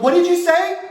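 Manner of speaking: high, loud outraged "What did you say?"; a mother catching her kid swearing; mom scolding. Retold or imitated. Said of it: "She'd be like".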